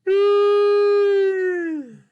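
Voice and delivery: a big, high, stretched-out cartoon yawn; huge yawn. Puppet yawns hugely in front of the kids, high and loud. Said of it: "notice some yawning".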